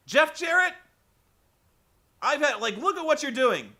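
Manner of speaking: loud, high angry call to Jeff; fed-up commentator losing it at a wrestler; fed-up outburst. Retold or imitated. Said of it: "has had enough".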